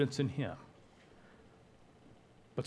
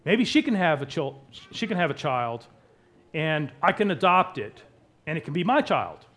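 raised, loud, eager pitching of a plan; desperate Rachel; 5 semitones higher, 7 dB louder than usual. Acted. Wife proposing her maid as surrogate, desperate and eager. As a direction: push the idea urgently. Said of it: "Rachel starts this in her desperation".

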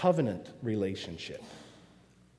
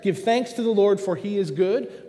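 higher, louder, proclaim it to the room; ringing proclamation; 5 semitones higher, 5 dB louder than usual. Acted. Preacher to congregation, calling out the opening verse, ringing and loud. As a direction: lift the praise line up and out.

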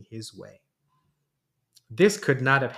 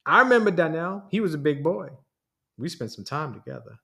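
usual speaking voice

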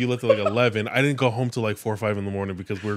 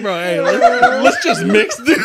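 loud, slow laughing plea; 11 semitones higher, 11 dB louder, slower than usual. Retold or imitated. Asking his friend to just keep mixing, laughing. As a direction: plead through the laughter. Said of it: "It's like".

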